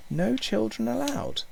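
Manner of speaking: high, drawn-out echo of her words; doubting echo. A narrator's reading. Boy turns over the waitress's lie, doubting echo.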